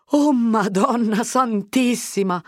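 high, loud, slow gasp of amazement; astonished exclamation. A narrator's reading. Agnese recognises Fermo from the window, exclaiming amazed. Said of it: "sclamò Agnese".

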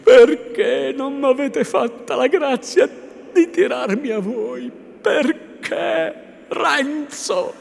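high, loud, anguished plea; grief-stricken high female voice; Lucia, sorrowful. A narrator's reading. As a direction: lament in despair, stretching the questions. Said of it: "esclamò dolorosamente Lucia".

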